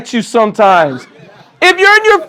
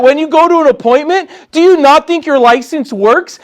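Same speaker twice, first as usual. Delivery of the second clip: normal talking voice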